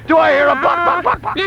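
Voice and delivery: high-pitched rallying call ending in clucks; mock chicken call.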